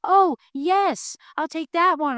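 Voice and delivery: little animals grabbing donuts, high and eager. Acted. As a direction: chirp out the yes. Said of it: "All the little animals who say".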